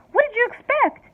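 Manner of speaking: panicked mother wailing. A narrator's reading. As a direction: wail in despair. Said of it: "wailed Missus T V".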